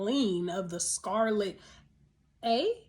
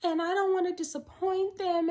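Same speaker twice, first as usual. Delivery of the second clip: mock quote; 8 semitones higher, 3 dB louder than usual. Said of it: "She's like".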